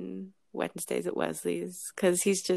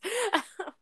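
short laugh; 13 semitones higher than usual. Real, not acted. Laughing at own remark.

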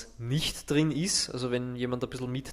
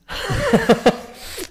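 one sudden loud sneeze; sneezing; 9 dB louder than usual. Real, not acted. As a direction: let a real sneeze burst out. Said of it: "ich muss niesen".

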